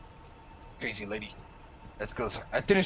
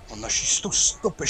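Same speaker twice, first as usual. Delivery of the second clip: normal talking voice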